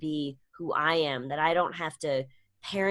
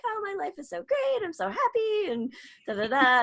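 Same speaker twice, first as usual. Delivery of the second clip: mock gushing post; 13 semitones higher than usual. Mocking her own Facebook post.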